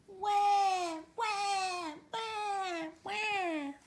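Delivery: put-on high wailing baby cry. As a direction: wail like an unhappy baby. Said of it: "the baby was yelling".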